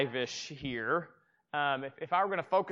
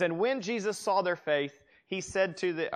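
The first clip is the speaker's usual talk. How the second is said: raised, measured Bible reading; scripture reading; 3 semitones higher, 3 dB louder than usual. Acted. Pastor reading the verse to his congregation, raised and measured. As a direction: read the verse out to the room.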